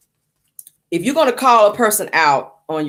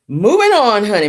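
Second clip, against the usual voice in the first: higher and louder, brisk announcement; emphatic moving on; 4 semitones higher, 5 dB louder than usual. Acted. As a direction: sweep the topic aside with a loud flourish. Emphatic topic switch.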